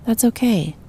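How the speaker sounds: normal talking voice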